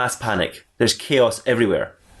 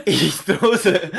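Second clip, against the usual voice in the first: laughing; 9 semitones higher, much slower than usual. Real, not acted. Narrator cracks up mid-line.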